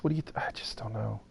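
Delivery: normal talking voice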